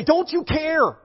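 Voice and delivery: high-pitched, indignant plea; acted reproach. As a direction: voice a desperate disciple's accusing question. Voicing disciples' reproach to Jesus.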